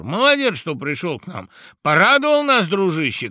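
high, loud bursts of welcome; shouts of tipsy guests. A narrator's reading. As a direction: greet him with noisy revelry. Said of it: "кричали подгулявшие гости".

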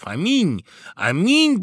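high, loud cheer rising in force; shouted exclamations. A narrator's reading. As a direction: call out like rejoicing guests. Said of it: "Это закричали званые гости".

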